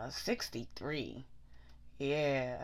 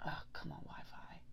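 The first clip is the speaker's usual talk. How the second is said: frustrated whisper at the Wi-Fi; muttering whisper; 11 dB quieter than usual. Real, not acted. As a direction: breathe it out in annoyance. Frustrated at slow Wi-Fi.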